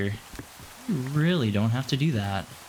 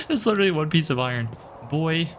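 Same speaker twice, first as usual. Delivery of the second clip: excited; 6 semitones higher than usual. Real, not acted. Delighted at finding one iron.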